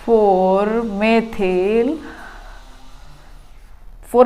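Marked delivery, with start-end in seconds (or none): 0.0-2.0 "four methyl" stretched out very slowly; drawn-out naming for the board; slow dictation. Shown as a demonstration. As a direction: dictate the name word by word. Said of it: "तो हम बोलेंगे".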